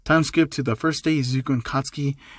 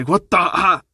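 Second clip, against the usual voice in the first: raised, higher voice breaking into a yelp; louder, alarmed exclamation; startled cry; 4 semitones higher, 5 dB louder, slower than usual. Acted. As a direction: act out a shocked outburst. Character's limbs suddenly rearranged.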